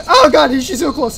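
loud burst of panic; alarmed shout. Real, not acted. Player running as she closes in, alarmed. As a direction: break off and shout in fright. Pursuer closes in mid-sentence.

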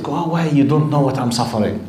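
ordinary delivery

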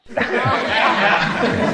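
high-pitched burst of laughter; helpless, embarrassed laugh at a tongue-slip; laugh at own slip. Real, not acted. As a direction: crack up after fumbling a word.

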